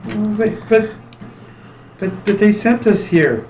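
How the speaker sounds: put-on high, hesitant young girl; timid girl voice. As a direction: ask small and unsure.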